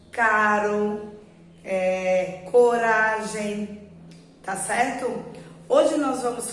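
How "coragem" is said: "coragem" drawn out slowly with a tapped R; slow teaching example; slow example word. Shown as a demonstration. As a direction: stretch "coragem" so the tap in the middle is heard. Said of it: "o som do R bater lá no céu da boca".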